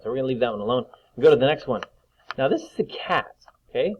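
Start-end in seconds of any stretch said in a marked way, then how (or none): none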